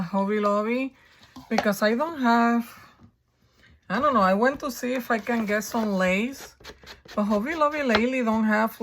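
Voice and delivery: ordinary delivery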